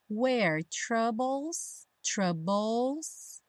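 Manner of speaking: slow "troubles" ending in "-bols"; BLE as "bol". Shown as a demonstration. Teacher modelling for learners, slow "troubles" ending "-bols". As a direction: draw out "troubles", second syllable said "bol". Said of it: "é sempre dol".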